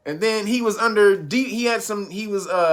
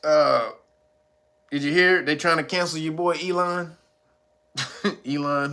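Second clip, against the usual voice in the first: ordinary delivery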